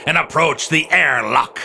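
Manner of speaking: steady storyteller voice building to the landing; radio-drama narrator telling the pirates' journey to the moon; story narration. A narrator's reading. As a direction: narrate the voyage like an adventure serial.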